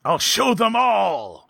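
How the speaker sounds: slow, high villain declaration; mad scientist. Pretend.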